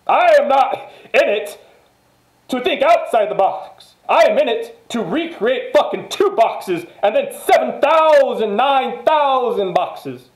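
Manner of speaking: high, loud, laughing boast, slowing on the nine thousand; hyped boast. Real, not acted. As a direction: shout it out, riding the hype. Boasting about recreating the box.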